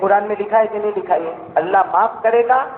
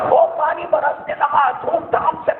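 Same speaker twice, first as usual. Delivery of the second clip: rain pouring everywhere, voice climbing high; rising fervour; 9 semitones higher than usual. Acted. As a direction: build the joy as the rain spreads. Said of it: "بولو سبحان اللہ".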